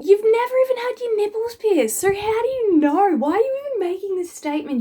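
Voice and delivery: high, loud exasperated questions; incredulous rant. Acted. Piercer ranting at an imagined customer, shrill disbelief. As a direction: fire the questions at them in disbelief.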